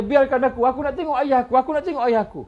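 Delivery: high, loud desperate pleading; pleading cry. Acted. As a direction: beg them to let you see your father. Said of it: "Maka dia kata".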